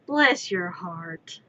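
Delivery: slow, syrupy Southern bless your heart; southern sweetness. Retold or imitated. Southern put-down to someone who damns you: slow, sugary Southern accent. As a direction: drawl it out like a Southern lady being sweet but cutting. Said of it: "a southern way of saying".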